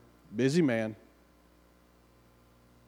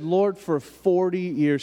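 retold plea, slow and higher; pleading prayer; 4 semitones higher, slower than usual. Wife cries out to the Lord over her husband, retold pleading. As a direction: draw out a weary appeal to God.

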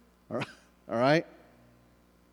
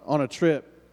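usual speaking voice

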